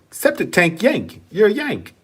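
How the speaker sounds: high mock Australian accent; imitated Australian girl. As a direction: mimic an Australian girl, pitched well up. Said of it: "And she's like".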